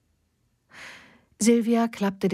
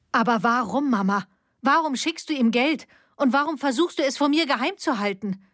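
high, pressing questions; insistent questioning; 7 semitones higher than usual. A narrator's reading. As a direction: push her for the truth. Daughter demanding reasons.

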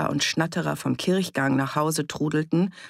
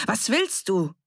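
sharp, higher snap; irritated, raised-pitch rebuke; snapping at him; 6 semitones higher than usual. A narrator's reading. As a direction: bark at the boy to go away. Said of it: "herrschte Annie ihn an".

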